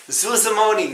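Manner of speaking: a light put-on American accent, high; imitating the dubbed American voice of the film; mock American accent. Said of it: "why it's got an American accent".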